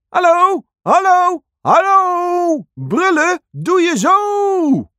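very high, loud monkey call. Acted. Monkey shows the others how to roar. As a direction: shriek it like a cheeky monkey.

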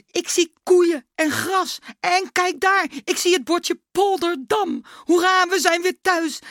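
high, loud, savouring each sight; overjoyed boy. A narrator's reading. Klaas telling his dad they are home, overjoyed high voice. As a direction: cheer out the sights with rising delight.

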